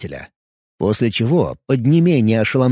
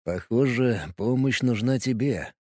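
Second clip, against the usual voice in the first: smirking; 8 dB quieter than usual. Acted. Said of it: "усмехнулся Варп".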